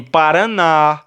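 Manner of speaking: slow "paraná", weight on the final NÁ; last-syllable stress. Shown as a demonstration. Teacher giving students an oxytone example, stress on final NÁ of "paraná". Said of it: "com um acento na última sílaba".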